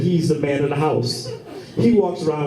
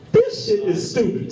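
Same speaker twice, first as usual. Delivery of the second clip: very high incredulous complaint; comic exasperated rant; squeaky exasperation. Real, not acted. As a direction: squeal it in disbelief. Ranting about frisbee golf.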